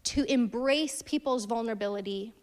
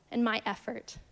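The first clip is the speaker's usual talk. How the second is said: ordinary talk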